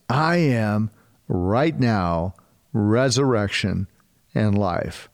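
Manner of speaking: "I am right now" very slowly, weighted; slow emphatic. Shown as a demonstration. Preacher imprinting Easter message, slow and deliberate. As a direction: spell it out slowly for them to imprint. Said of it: "imprint it in your mind".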